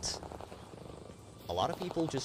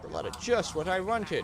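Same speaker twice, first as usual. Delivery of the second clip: high, sarcastic fake gratitude; mock pleased at a bad gift; faking delight at gift; 8 semitones higher than usual.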